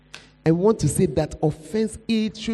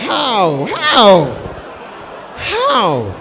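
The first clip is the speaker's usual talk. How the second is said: loud, pleading preaching with cries of anguish; preaching at full force; 7 dB louder than usual. Acted. Preacher voicing a believer who cannot let go, loud and anguished. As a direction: plead it out to the hall like it hurts.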